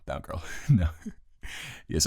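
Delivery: usual speaking voice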